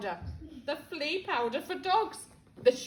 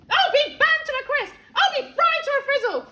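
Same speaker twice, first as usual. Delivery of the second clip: much higher, louder yell; 10 semitones higher, 9 dB louder than usual. Acted. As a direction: bellow it. Grandma keeps yelling.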